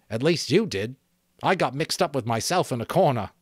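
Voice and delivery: sheepish twin owning up to a muddled fight; boy's voice, sheepish. Acted. Twin confessing to Ralph after the raid, lightly voiced boy.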